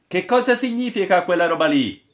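high, loud, puzzled demand; baffled question. A narrator's reading. Sailor asks about the sign on the mast, baffled. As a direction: raise the voice in bewildered questioning. Gabbiere questions the odd notice.

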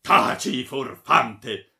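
very loud, angry command; shouted threat. A narrator's reading. Baron raising his sword on the friar, shouting. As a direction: shout him down. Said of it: "gridò il barone alzando la spada".

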